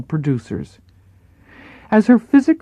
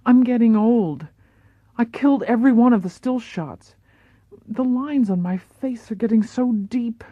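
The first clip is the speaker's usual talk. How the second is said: higher, worried starlet voice; anxious Marilyn; 7 semitones higher than usual. Acted. Marilyn to her acting coach, higher and fretful. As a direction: voice her fear of aging, pitched up. Marilyn laments aging to Paula.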